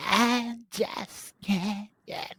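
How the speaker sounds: put-on slow creaky valley girl drawl; vocal fry. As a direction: drag it out in heavy vocal fry. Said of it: "mimicking the vocal fry".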